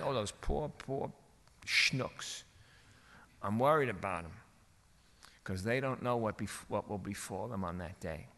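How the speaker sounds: hushed, sorrowful sympathy; pitying concern. Acted. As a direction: sink low and soft, worried for them. Acted pity for those in limos.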